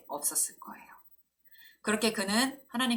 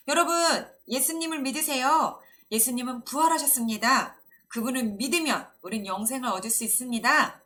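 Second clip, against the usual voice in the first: raised, louder street-preacher call; proclaiming; 5 semitones higher, 7 dB louder than usual. A narrator's reading. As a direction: announce good news to a crowd. Acting out Stephen preaching to passersby.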